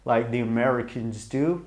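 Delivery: usual speaking voice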